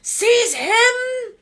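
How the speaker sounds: high, loud commanding scream. Acted. Voldemort ordering Quirrell to grab Harry, screaming. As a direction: scream the order at your servant. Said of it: "Voldemort screamed".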